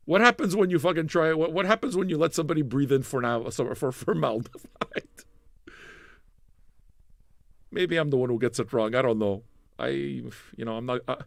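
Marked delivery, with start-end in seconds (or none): none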